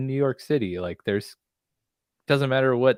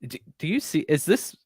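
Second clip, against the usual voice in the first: higher, frantic pleading question; panicked Elliot; 9 semitones higher, 5 dB louder than usual. Retold or imitated. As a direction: imitate Elliot losing it in the cab. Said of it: "asking the, the driver".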